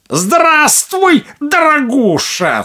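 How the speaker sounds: high, loud, slow sneering witch greeting; old witch. Acted. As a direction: greet her captive with fake sweetness.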